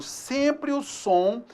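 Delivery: sempre o som said very slowly; slow explanation. Shown as a demonstration. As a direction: draw it out for the class. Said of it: "E esse ditongo".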